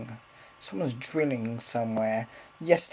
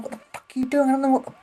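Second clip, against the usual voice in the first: angry rant; 11 semitones higher, much faster than usual. Real, not acted. Ranting at the driller.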